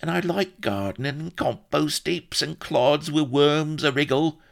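high, loud babbling rustic; gibbering. A narrator's reading. Solomon faces Blood's scorn, gibbering high. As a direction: gibber excuses, rising in panic. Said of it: "he began to gibber".

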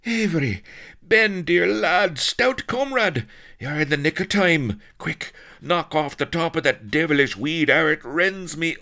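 very high, loud desperate joy; frantic hailing. A narrator's reading. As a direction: shout to your rescuer for urgent help. Blood hails his rescuer frantically.

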